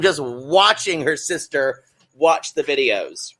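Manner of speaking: ordinary delivery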